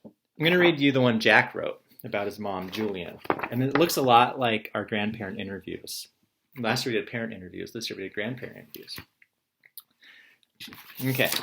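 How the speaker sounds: ordinary talk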